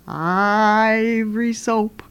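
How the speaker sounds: slow, high, stretched-out spooky voice; eerie, long-held vowels; ghostly voice. Acted. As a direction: intone it like a ghost delivering a punchline. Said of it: "And the voice said".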